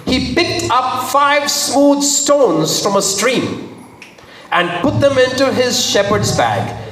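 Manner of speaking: high, emphatic story narration; dramatic scripture reading. A narrator's reading. Preacher reading David's preparation for battle to congregation, dramatic. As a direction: tell it big, lifting and stretching key phrases.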